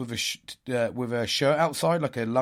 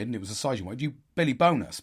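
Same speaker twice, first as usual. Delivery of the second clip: normal talking voice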